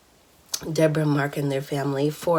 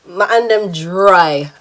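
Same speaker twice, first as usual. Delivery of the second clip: slow, loud Bajan accent; 9 dB louder, slower than usual. Shown as a demonstration. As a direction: put on a playful, drawn-out Bajan lilt. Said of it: "I have to say it in Bajan accent".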